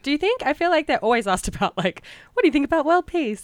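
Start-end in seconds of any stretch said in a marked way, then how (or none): 2.3-3.4 high, bright pageant-host voice; mock pageant question. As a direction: ask it like a cheesy pageant host. Mocking a typical pageant question.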